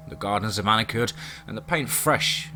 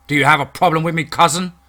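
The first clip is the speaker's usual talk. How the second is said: loud, angry confrontation; angry challenge; 3 semitones higher, 7 dB louder than usual. A narrator's reading. James confronts the cousin who hit him, angry and loud. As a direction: challenge him with anger welling up. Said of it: "see the anger well up in me".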